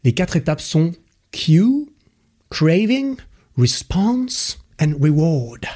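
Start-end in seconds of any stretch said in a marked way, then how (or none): 1.3-5.7 put-on the four English step names in a proud, high English accent, response and reward drawn out slowly; best English accent. Podcaster showing off his English accent on the book's terms. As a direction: show off your finest English accent on each word. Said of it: "j'ai sorti mon plus bel accent".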